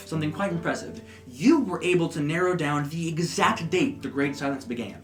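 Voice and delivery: usual speaking voice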